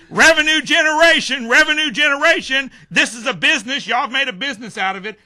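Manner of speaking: loud, high-pitched public outcry; retold yelling. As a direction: re-enact yelling an accusation at a crowd. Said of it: "started yelling".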